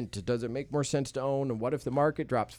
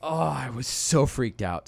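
ordinary talk, nothing marked

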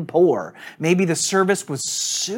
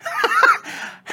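high cracking laugh; real burst of laughter. Real, not acted. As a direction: break up laughing. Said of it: "as I spit laughing".

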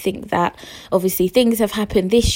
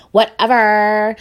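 sulky whatever; 2 semitones higher, 5 dB louder, slower than usual. Retold or imitated. Retold sulky reply, repeated louder.

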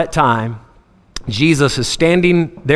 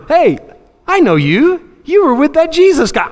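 put-on high-pitched accusing child; little girl; 12 semitones higher, 3 dB louder than usual. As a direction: point someone out in a put-on small girl's voice. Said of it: "Another little girl comes".

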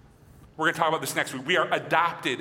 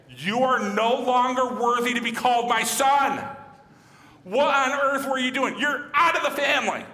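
higher, louder hammed-up furious dad; mock angry father; 7 semitones higher, 4 dB louder than usual. Preacher voices the dad who never said this, mock angry. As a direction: play the harsh father disowning his son. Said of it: "My dad did not say".

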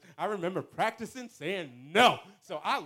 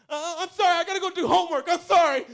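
panicked apology; 8 semitones higher, 10 dB louder, faster than usual. Retold or imitated. Panicking before the first kiss.

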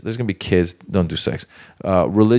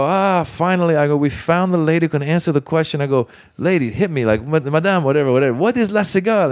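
hammed-up stage excitement; 8 semitones higher than usual. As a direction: work the crowd in a pitched-up hype voice. Crowd work retold on stage.

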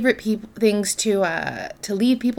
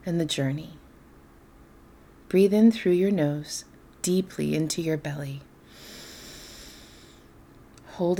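softer, lower-key meditation voice with long pauses; guided meditation; 3 semitones lower, 7 dB quieter than usual. Acted. Host leading listeners into darkness and quiet, guided meditation. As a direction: guide them gently into calm, leaving space between phrases.

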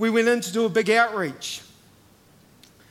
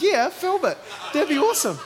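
high, eager yes; excited agreement; 9 semitones higher than usual. Retold or imitated. Preacher to teenager, eager high excited agreement. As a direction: jump at the idea, thrilled.

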